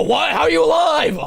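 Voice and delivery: very high, loud shriek of disbelief; incredulous shout. Real, not acted. Streamer stunned the storyteller survived, incredulous shout. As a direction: yell it in utter amazement.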